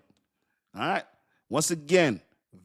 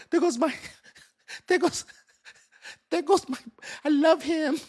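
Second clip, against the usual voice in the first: mock swoon; 11 semitones higher than usual. Mock swooning over crush.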